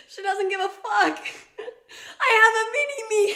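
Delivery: high, loud squeal through laughter; laughing shout. Real, not acted. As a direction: shriek with delighted laughter. Cracking up over her bold sister.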